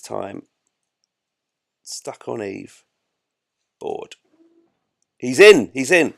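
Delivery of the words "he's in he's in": loud, sudden burst of relief; loud triumphant outburst; excited exclamation. Real, not acted. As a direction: cry out as it finally works. Player cheers kerbal getting aboard.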